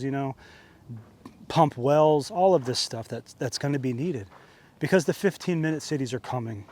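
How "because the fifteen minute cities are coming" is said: ordinary talk, nothing marked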